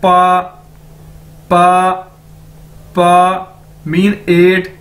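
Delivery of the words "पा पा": पा said loud and open; model pronunciation. Shown as a demonstration. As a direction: drill पा for bā. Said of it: "तो ये क्या है?".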